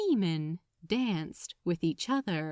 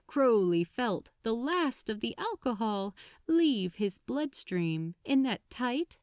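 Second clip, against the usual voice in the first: plain reading voice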